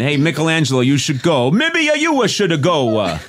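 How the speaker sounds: a hammed-up, terrible Italian accent; bad Italian accent. Mocking himself as Michelangelo in a bad Italian accent. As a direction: put on a clumsy fake Italian. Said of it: "your Italian accent sucks".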